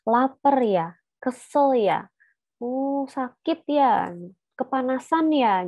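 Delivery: questioning voice for an infant; gentle questions to a baby. Shown as a demonstration. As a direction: ask the baby tenderly. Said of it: "banyak-banyak bertanya sama dia".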